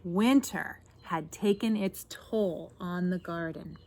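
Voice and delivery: picture-book narration. A narrator's reading. Reader tells children how plants woke, expressive storytelling. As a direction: build the hope, then settle gently.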